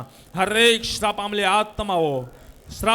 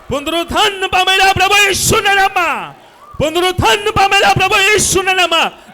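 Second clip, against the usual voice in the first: commanding exorcist's shout, rising higher; deliverance preaching; 7 semitones higher, 8 dB louder than usual. Real, not acted. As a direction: order the spirit out forcefully. Commands spirits to leave.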